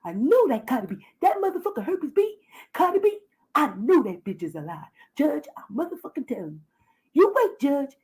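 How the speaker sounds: hammed-up begging the judge; mock Wiley pleading.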